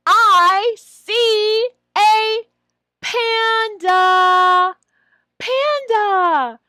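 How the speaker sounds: high, very slow, loud modelling; teacher voice. Shown as a demonstration. Trainer models the vocabulary sentence, slow, high and loud. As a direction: stretch every word for the child. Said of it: "and say".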